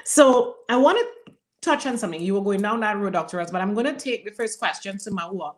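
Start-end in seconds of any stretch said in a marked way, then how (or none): none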